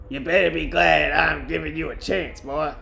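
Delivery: unconvincing woman's voice, slightly put on; attempted woman's voice. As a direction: lightly play a stern woman boss. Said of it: "it's supposed to be a woman's voice".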